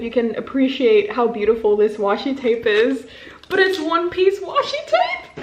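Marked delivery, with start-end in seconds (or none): 3.5-5.4 gleeful. Real, not acted. Delight over gift.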